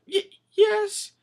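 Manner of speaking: high, stammered little reply; timid princess. Retold or imitated. Princess answers the pirate's call, timid stammer. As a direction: stumble into a meek answer.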